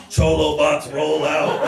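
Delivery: slow, drawn-out heroic rallying call; rally the troops as a mock robot leader; Optimus Prime. Uncle leading the gang into a fight, mock heroic command.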